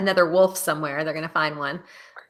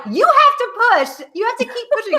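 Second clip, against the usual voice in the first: imitating the nurse; 3 dB louder than usual. Nurse urging the mother to push, high and insistent. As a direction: order her to push like a stern nurse. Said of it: "And the nurse was like".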